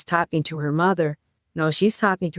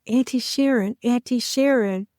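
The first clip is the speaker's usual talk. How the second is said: joyful greeting; 6 semitones higher than usual. Retold or imitated. Relatives greeting her joyfully.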